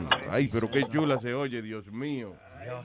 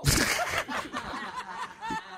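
real laughter, much higher; high burst of laughter; 5 dB quieter than usual. Real, not acted. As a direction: crack up, high-pitched. Host cracks up at co-host.

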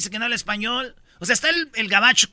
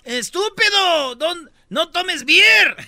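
high, louder, slow scolding; mock yell; 9 semitones higher, 7 dB louder, much slower than usual. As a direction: drag out the scolding. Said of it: "le grita". (grito de señora, agudo, fuerte y lento)

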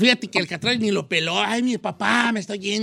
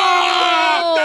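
stretched-out, higher shout of triumph; triumphant announcement; 8 semitones higher, 5 dB louder, much slower than usual. Real, not acted. Host to winning contestant, triumphant shout. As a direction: shout it long, like a game-show win.

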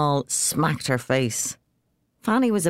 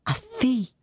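scandalised priest; 10 semitones higher than usual. A narrator's reading.

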